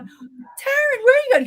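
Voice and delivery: high, loud anxious call; calling after her. Retold or imitated. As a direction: call after a fleeing friend.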